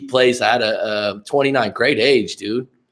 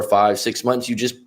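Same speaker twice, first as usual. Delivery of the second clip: usual speaking voice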